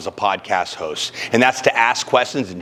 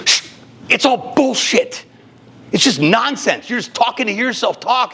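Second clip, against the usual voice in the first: stern teacher; 11 semitones higher than usual. Retold or imitated. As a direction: scold him like she did. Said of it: "she goes".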